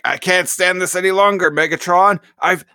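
high, loud, strained robot whine; frantic Terrorsaur. Retold or imitated. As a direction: squeal it like he's losing it. Terrorsaur cracking up in cramped space.

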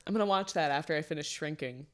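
ordinary delivery

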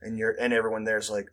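ordinary talk

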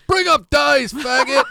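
high, full-volume crowd shout; screaming heckler. Retold or imitated. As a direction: imitate a hostile heckler screaming at the stage. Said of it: "Like, screaming at me".

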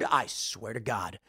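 ordinary talk, nothing marked

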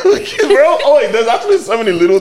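high, laughing excitement. Real, not acted. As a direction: laugh through the words. Bursts out laughing mid-story.

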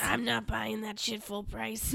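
put-on slow, playful Scottish accent. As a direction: play the stingy Scot, slowly. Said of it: "But are you Scottish?".

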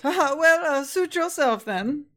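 succubus voice. Acted. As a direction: shrug him off with a haughty laugh. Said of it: "And she goes".

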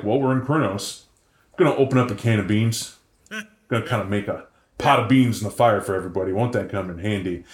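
a hint of a relaxed camper; mock laid-back campfire chatter; cosy camper. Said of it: "they sit around and they're like".

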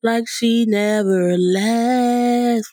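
stretched-out, sing-song hype; playful hype intro. Pretend. Playful hype opening before "let me be serious.

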